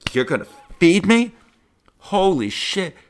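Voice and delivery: high, loud, overjoyed yelp; mock gasp at being fed and paid; excited disbelief. Retold delight at free food.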